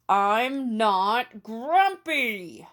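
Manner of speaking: loud, slow, drawn-out furious yell; angry shout. Acted. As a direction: bellow it while beating your chest. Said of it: "shouted Jim as he beat his chest".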